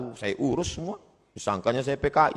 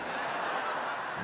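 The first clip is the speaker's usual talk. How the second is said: soft breathy laugh; 12 dB quieter than usual. Real, not acted. Tertawa soal dosen dan mahasiswi.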